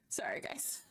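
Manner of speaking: soft unvoiced apology; whispering. Real, not acted. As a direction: breathe it, barely voiced. Whispered apology after muting.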